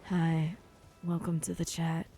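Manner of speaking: low husky fake real voice; deep real voice. Shown as a demonstration. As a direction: drop into a low mock-man voice. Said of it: "This is my real voice".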